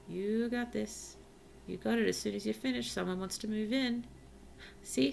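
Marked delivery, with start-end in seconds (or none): none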